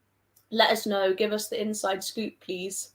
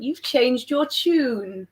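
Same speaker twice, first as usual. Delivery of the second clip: teasing; 4 semitones higher, much slower than usual. Real, not acted. Teases friend's change of opinion.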